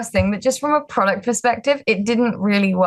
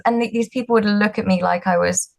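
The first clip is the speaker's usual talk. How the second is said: ordinary delivery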